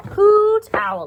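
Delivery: high, loud happy cry of the name; delighted squeal. Real, not acted. Teacher delights her young class with the owl.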